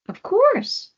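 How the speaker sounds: very high, loud cheerful yes; owl agreeing. Acted. Owl agrees to meet the group, high cheerful. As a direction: chirp it brightly.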